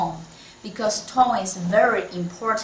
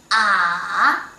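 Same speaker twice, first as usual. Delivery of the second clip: Ah long, loud, down and then up; dipping third tone; 6 dB louder than usual. Shown as a demonstration. Teacher reviewing tones in order, falling-rising Ah. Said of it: "From first tone to last tone".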